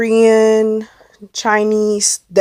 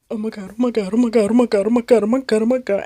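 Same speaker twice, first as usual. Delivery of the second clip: panicked muttering; 4 dB quieter, faster than usual. Retold or imitated. Acting own panic while being called.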